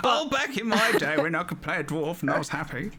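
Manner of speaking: put-on lightly laughing old-timer reminiscing; a hint of grumpy grandpa; old man voice. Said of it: "Rook appreciates my old man voice".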